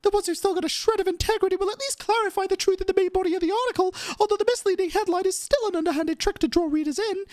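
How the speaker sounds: high, theatrical righteous complaint; indignant reporter character. Acted. Reporter denouncing third-rate tabloids, high and indignant. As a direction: rant in a high, put-on character voice. Voices Charlotte in game.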